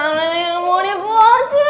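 slow wail over lost hair; drawn-out crying. Real, not acted. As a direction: sob it out slowly. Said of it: "ஏன்பா அழுகுற?".

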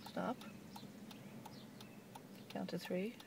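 normal talking voice

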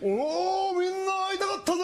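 put-on high, excited turtle voice calling out to the kids; hyped-up sea turtle greeting fans.

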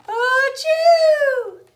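huge high, loud bear sneeze; big sneeze. A narrator's reading. Bear woken by pepper, huge loud sneeze. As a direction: blast it out like a giant sneeze. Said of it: "He blows and he sneezes".

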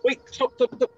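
high, panicked pleading. Acted. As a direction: blurt it out in a panic. Weapon pointed at him.

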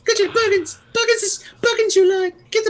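high sped-up squeaky voice; chipmunk voice. Retold or imitated. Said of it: "our voices sound like chipmunk voices".

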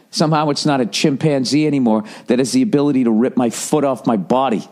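ordinary talk, nothing marked

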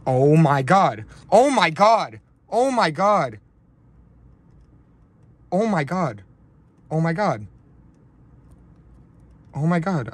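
loud, high cries of disbelief; real shock at a huge pull, voice shooting up; stunned excitement. Real, not acted. Card opener reacting to a huge Wayne Gretzky hit, stunned excitement.

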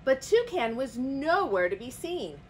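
usual speaking voice

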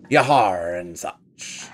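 slow drawn-out pirate growl. Acted. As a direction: drag out the pirate yahar. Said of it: "my amazing pirate voice".

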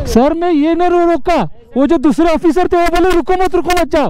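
high-pitched, agitated pleading with the officer; heated protest. Real, not acted. Arguing with a traffic officer. (ऊँची आवाज़ में झुंझलाकर अफ़सर से बहस)

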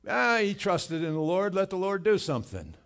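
high, loud scornful heckle; loud, derisive taunting; mocking jeer. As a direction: heckle him from the crowd with contempt. Acting out the jeering Pharisees.

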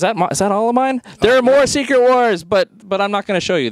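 sudden high excited burst. Real, not acted. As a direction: blurt it out thrilled. Excited discovery of more comics.

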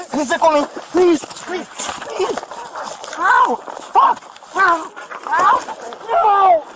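rising desperate pleas and yelps; pleading in pain. Real, not acted. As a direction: beg as the arm bends. Man pinned, arm twisted.

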